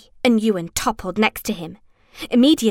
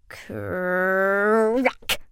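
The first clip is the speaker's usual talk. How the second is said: ordinary delivery, nothing marked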